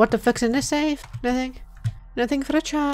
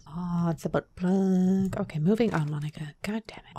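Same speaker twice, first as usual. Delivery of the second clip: hushed, breathy whisper to herself; 4 semitones lower, 5 dB quieter than usual. Real, not acted. Streamer whispering to herself over the game. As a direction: whisper the swear under her breath. Streamer muttering while playing alone.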